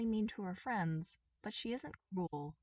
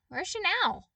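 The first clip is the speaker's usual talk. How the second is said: eager question; 13 semitones higher, 9 dB louder than usual. Acted. Toph asks about his mom.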